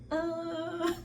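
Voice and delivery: high wordless "uh" breaking into laughter; high drawn-out mock groan, then laugh; mock dismayed cry. Watching waves crash on friend, high mock cry then laughter.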